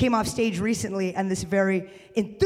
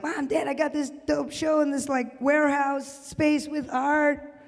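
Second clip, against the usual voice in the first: high-pitched proud announcement to parents; eager bragging; 6 semitones higher than usual. Acted. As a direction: pitch it up, bursting to share the news. Excited news told to parents.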